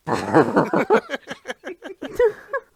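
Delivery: high, loud burst of laughter; high laughing fit. Real, not acted. Host cracking up after the mock statement, high laughter. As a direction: dissolve into giggles at your own joke.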